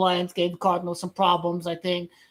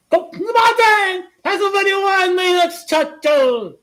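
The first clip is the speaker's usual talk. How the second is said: shouted high and loud; excited shout; 10 semitones higher, 10 dB louder than usual. Real, not acted. Streamer hyped, yelling at his chat, high and loud. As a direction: yell it out to the chat, pumped.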